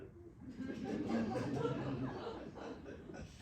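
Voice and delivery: breathy laugh, no voice, fading down; airy unvoiced laugh. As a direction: let out a breathy unvoiced chuckle. Rainey laughs after teasing.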